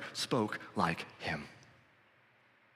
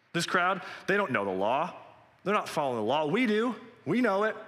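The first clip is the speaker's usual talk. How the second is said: proud boasting; 7 semitones higher than usual. Retold or imitated. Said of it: "This crowd does not know the law".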